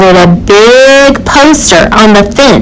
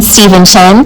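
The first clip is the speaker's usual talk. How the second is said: ordinary delivery, nothing marked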